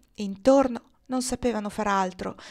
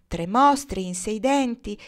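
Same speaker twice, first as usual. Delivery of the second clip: ordinary delivery, nothing marked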